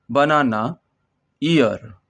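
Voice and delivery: ordinary talk, nothing marked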